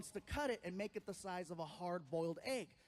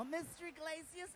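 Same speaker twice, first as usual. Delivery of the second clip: high, softly projected call-in voice; nurse calling patient; 6 semitones higher, 3 dB quieter than usual. Retold or imitated. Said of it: "they call me in".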